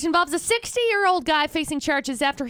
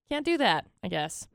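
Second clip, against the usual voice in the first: ordinary talk, nothing marked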